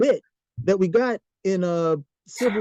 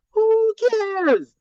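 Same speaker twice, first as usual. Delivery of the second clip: high, loud dismissal; scoffing outburst; 12 semitones higher, 5 dB louder than usual. Real, not acted. Scoffing at tragic villain backstories, loud and much higher. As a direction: blow the idea away in a burst.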